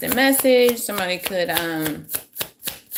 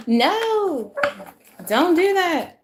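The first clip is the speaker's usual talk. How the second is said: startled cry; 11 semitones higher, much slower than usual. Real, not acted. Cards slipping during live reading.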